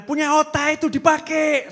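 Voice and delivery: high, loud scolding shout; shrieking mother scolding her child; mock yell at child. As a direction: yell at the kid like an angry mother. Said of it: "sama teriak-teriak".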